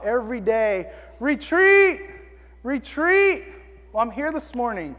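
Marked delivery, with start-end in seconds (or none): 1.2-3.4 high, moderately loud mock yell; retold yell. Preacher voicing what you yell to yourself every day, mock yell. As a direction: echo a panicked soldier's cry. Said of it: "you've yelled to yourself".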